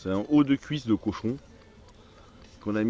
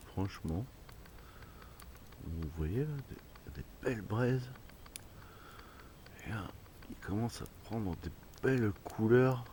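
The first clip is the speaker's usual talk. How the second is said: softly spoken night commentary; hushed voice; 3 semitones lower, 13 dB quieter than usual. Real, not acted. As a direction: keep it low by the fire. Quiet night commentary by fire.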